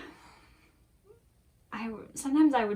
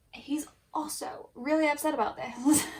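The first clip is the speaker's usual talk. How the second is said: hushed whisper; 6 semitones higher, 5 dB louder than usual. Real, not acted. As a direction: whisper so the hamster can rest. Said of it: "My hamster's awake".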